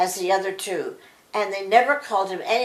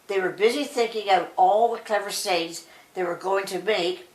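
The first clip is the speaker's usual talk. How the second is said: plain reading voice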